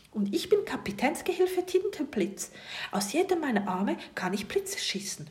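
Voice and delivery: stolz, ein Hauch höher. A narrator's reading. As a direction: sich wichtig vorstellen. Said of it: "fügt er stolz hinzu". (Tintenfisch stellt sich dem Kind stolz vor)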